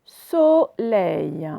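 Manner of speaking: "Soleil" drawn out in two syllables. Shown as a demonstration. Teacher showing O at the very start, syllable by syllable. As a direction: hold "So" apart at the start of "Soleil". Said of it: "je l'ai prononcé au tout début".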